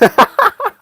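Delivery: high, loud cackle; real burst of helpless laughter; laughing. Real, not acted. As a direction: crack up at your own joke. Cracks up at own impression.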